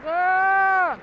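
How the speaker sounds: loud, high whooping cheer. Real, not acted. Cheering on top of chicken.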